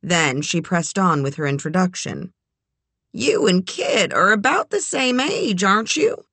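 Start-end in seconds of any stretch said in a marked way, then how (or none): 3.1-6.2 high, bright matchmaking lady; lightly voiced eager older woman. A narrator's reading. Eleanor voiced.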